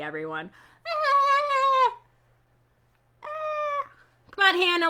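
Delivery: high, loud goofy squeals; be playfully goofy with the kids; silly. Pretend. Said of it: "Silly-ish".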